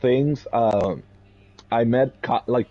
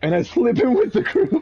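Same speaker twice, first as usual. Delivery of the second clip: laughing; 13 semitones higher than usual. Real, not acted. Laughing through telling.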